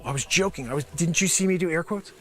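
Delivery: normal talking voice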